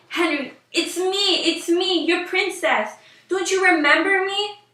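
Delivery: high, loud pleading scream; yelling. Acted. Princess screaming up at the prince who rejects her, pleading yell. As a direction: scream up at him, begging to be recognised. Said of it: "She started yelling and screaming".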